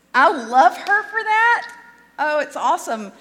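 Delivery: high, loud burst of delight; delighted enthusiasm. Real, not acted. Preacher praising the bishop's order to go to the movies, excited. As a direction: gush with real excitement.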